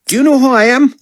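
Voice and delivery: very high, self-important artiste voice; sassy artiste. A narrator's reading. Artiste leaving a second voicemail, haughty and indignant. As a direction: announce yourself like an outraged diva. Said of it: "nasty rantings of a sassy artiste".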